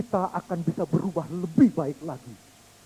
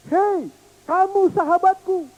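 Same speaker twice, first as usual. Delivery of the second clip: put-on high, louder child's voice; child calling kindly; 11 semitones higher, 5 dB louder, slower than usual. Little boy calling friendship to the hills, high child voice.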